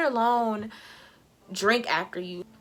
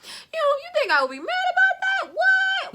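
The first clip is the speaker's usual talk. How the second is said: much higher and louder, exaggerated disbelief; mock disbelief; 5 dB louder than usual. Mock shock at her date's excuse. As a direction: squeal out the fake surprise. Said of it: "I'm like".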